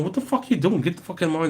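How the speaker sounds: high, heated mock yell at the bikies; retold angry yell. Drunk man yelling at bikies blocking him, high heated mock yell. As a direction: snap it out like a drunk picking a fight. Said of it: "I'm like".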